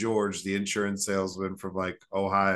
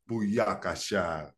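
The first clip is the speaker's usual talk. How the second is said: slow, drawn-out mock Jamaican exclamation; mock Jamaican rude boy; much slower than usual. Castaway greeting his rescuers with a slow mock Jamaican shout-out. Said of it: "rude boy Jamaican".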